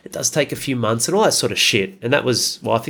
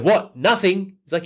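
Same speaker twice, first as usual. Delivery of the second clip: put-on drunk mate laying into him; 8 semitones higher than usual. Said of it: "just fucking hooked into him".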